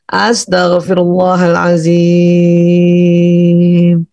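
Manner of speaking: very slow chant, last syllable held; full istighfar. Shown as a demonstration. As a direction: stretch it out on one steady note. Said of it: "yang penuh supaya pas waktu dilafazkan".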